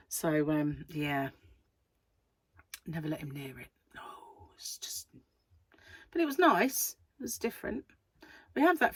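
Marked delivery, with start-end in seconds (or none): none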